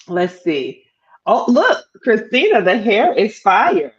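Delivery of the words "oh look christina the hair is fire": normal talking voice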